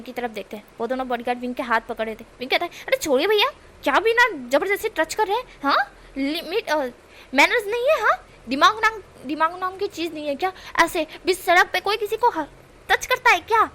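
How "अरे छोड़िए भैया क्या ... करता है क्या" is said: high, loud scolding protest; outraged complaint. Acted. Wing scolding the bodyguards, high loud outrage. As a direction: tell them off for grabbing you, pitched up. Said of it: "इस तरह से wing बोलता है".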